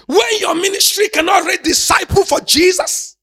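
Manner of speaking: sing-shout it to the crowd; shouting the line over the music; full-force shout. Real, not acted.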